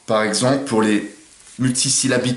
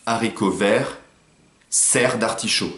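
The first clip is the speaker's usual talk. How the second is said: serre d'artichaut dit très lentement; étirer chaque syllabe; syllabes lentes; slower than usual. Shown as a demonstration. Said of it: "Par exemple". (exemple de rime prononcé lentement)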